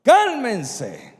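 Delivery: loud, high commanding rebuke; Jesus commanding wind and waves, loud; rebuking. Acted. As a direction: order the storm to be still. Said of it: "reprendió al viento".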